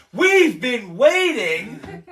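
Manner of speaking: loud, high, very drawn-out complaint; impatient comic rant; exasperated outburst. Real, not acted. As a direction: stretch every word in loud exasperation.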